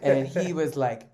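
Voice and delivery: usual speaking voice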